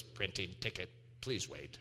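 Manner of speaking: low, quiet robotic ticket machine. Retold or imitated. Preacher mimics the garage ticket machine, low robotic voice. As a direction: mimic a flat automated kiosk, low and soft.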